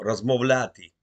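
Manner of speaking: Размовляти said slowly and clearly as the right word, stressed on ля; Размовляти, offered as the proper Ukrainian instead of балакать; correcting the word. Shown as a demonstration. A caller correcting his rival, Размовляти said deliberately. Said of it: "Балакать нету слова".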